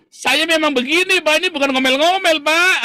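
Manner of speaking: high, loud indignant protest; confused protest. Retold or imitated. Bu Lianti protests she is not nagging, high and flustered. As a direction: pitch it up, flustered and offended. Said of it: "Bu Liantinya bingung".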